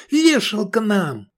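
high, slow despairing cry; panicked yell. A narrator's reading. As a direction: wail that it's over. Navigator panics.

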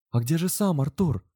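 high, anxious question; bated breath. A narrator's reading. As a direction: ask it holding your breath. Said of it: "затаив дыхание".

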